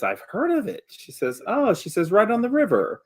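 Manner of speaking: high, lightly voiced older villager's wife. Game master plays the wife.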